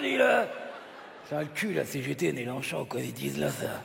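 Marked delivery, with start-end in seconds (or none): 1.3-3.8 low, soft monotone grumble; grumbling aside. Acted. Puppet complaining about the partner to himself, grumbling low. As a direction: grouse to yourself in a low litany.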